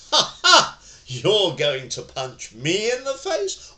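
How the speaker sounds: high mocking "Ha ha", then a loud taunt; mocking laugh. Shown as a demonstration. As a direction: taunt the attacker, laughing at the threat. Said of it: "any other kind of tone of voice".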